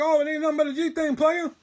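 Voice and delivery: high, loud and fast rap-style line; [name]-style slang. Retold or imitated. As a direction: rattle it off like a nineties rapper. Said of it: "I swear to God ... That's really what I said".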